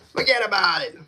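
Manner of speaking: high-pitched, hammed-up New York catchphrase; mock New York accent. Streamer playing up the accent viewers hear, mock New York. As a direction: toss it off like a caricature New Yorker. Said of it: "I sound like Boston or New York".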